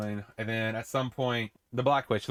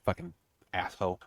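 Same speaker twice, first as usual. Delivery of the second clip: usual speaking voice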